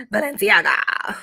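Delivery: ordinary delivery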